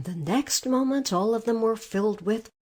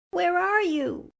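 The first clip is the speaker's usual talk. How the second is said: put-on young girl calling; 11 semitones higher, 3 dB louder than usual. Dorothy calls out, searching.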